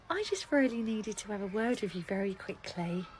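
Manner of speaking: muffled secretive talking after a sigh; odd guarded voice. Acted. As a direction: talk oddly so the boy outside won't notice. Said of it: "Why are you talking like that?".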